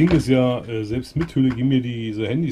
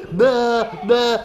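laugh then mock disgust; 10 semitones higher, 6 dB louder than usual. Disgusted play at rubbish on head.

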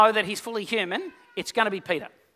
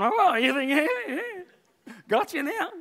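mocking disciples; 5 semitones higher, slower than usual. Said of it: "other eleven are going".

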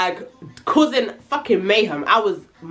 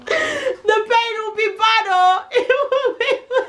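laughing mock warning; 14 semitones higher than usual. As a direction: giggle through the threat of pain. Mocking the coming beating.

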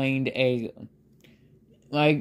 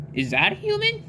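Papyrus voice; faster than usual. Acted. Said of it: "And he says".

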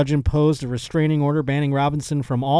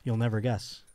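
softer, low voice with teasing pauses; soft mock reveal; 5 semitones lower, 7 dB quieter than usual. Retelling a sketch's reveal of the cauliflower, quiet and low. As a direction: draw out the reveal quietly, pausing before the last word. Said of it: "she goes".

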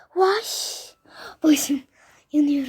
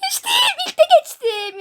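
loud laughing cheer, pitched up; laughing triumph; 14 semitones higher, 5 dB louder than usual. Real, not acted. Delighted laughing shout. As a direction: gamer cheering her first-try clear, laughing. Cleared the jump first try.

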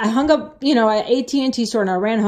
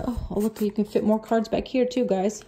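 aside; 8 dB quieter than usual. Real, not acted. Aside about fitting cards.

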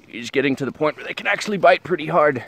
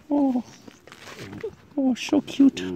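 cooing; 11 semitones higher, 3 dB quieter, slower than usual. Real, not acted. Vlogger melting over puppies, high cooing voice. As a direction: coo over something tiny.

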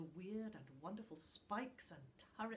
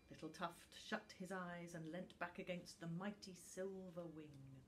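plain reading voice

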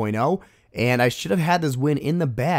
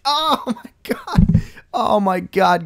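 cracking up much higher, slow; disbelieving laugh; 9 semitones higher, 4 dB louder, much slower than usual. Real, not acted. Gamer watching the tower survive, laughing in disbelief. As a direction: laugh out the shock.

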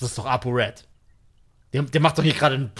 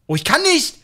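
frustrated outburst; 9 semitones higher, 4 dB louder than usual. Real, not acted. Cannot leave the room in game.

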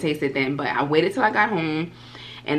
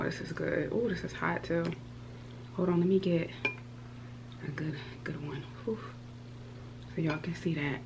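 whisper; 14 dB quieter than usual. Real, not acted. Quiet eating aside to camera.

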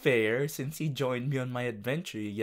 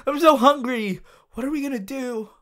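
high whiny despair; 11 semitones higher, 9 dB louder, faster than usual. Pretend.

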